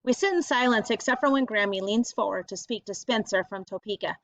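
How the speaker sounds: usual speaking voice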